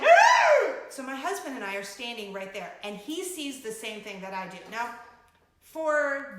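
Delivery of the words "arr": loud high startled cry; panicked yelp. Retold or imitated. Mom recalling her shocked yelp at the ride. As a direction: let out a sudden alarmed shriek. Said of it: "and I'm like".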